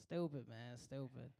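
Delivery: low, soft mutter to himself; muttered aside. Real, not acted. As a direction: shake your head and mutter it under your breath.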